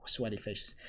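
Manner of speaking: usual speaking voice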